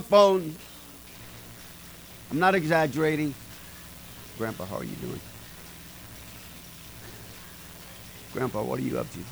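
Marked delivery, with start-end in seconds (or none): 4.4-9.2 much softer and lower, gently asking. Retold or imitated. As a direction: speak low and calm, no yelling. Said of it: "I would just sit there".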